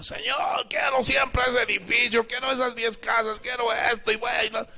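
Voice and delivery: mock a spoiled believer begging God for things; greedy demanding believer. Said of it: "hagas lo que se te dé tu gana".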